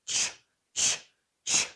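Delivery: short repeated छ sound. Shown as a demonstration. Teacher isolates the ch ending of March, crisp. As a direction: bite off the छ. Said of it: "वो छ का sound".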